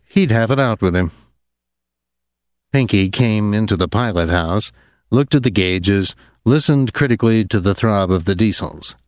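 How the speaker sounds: plain reading voice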